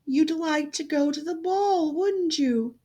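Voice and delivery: gently ask, a touch of a fairy voice; fairy godmother. A narrator's reading. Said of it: "Her godmother, who was a fairy, said".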